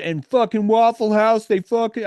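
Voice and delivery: usual speaking voice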